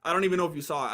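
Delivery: ordinary talk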